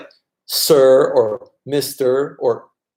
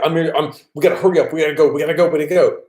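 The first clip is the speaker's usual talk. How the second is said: mock rushed foreigner; 3 semitones higher, much faster than usual. Foreigner hurrying Thais along, fast and pushy. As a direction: rattle it off fast, pushy and hurried. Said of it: "you're like".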